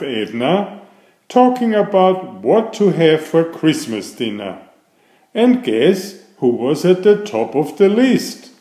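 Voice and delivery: lively read-aloud story voice; storytelling. A narrator's reading. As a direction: read it like a picture book to kids. Reads a picture book aloud.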